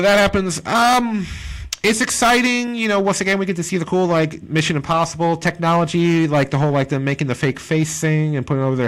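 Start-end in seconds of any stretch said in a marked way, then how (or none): none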